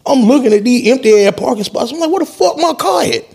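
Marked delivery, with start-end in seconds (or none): 2.1-3.2 much higher, alarmed outburst; shocked question. Retold or imitated. Panicked owner staring at an empty parking spot, much higher. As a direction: ask it high, stunned the car is gone.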